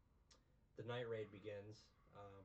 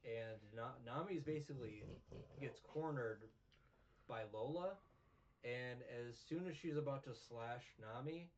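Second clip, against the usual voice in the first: normal talking voice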